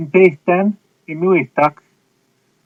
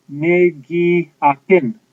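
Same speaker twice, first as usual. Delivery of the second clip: Ne gi apin, drawn out and slow; slow model phrase; much slower than usual. Shown as a demonstration. Teacher models the Cree phrase to students, slowly. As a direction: lay out each syllable slowly. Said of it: "you would say".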